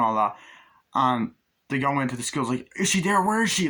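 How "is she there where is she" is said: high panicked calling, louder; mock frantic yell; frantic shout. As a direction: call out searching in a panic. Frantic search in school.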